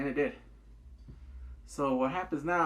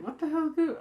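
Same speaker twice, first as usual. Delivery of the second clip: high, loud indignant outburst; imitating a boyfriend's indignant outburst; outraged; 13 semitones higher, 4 dB louder than usual. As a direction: act wronged and stunned. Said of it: "he was like".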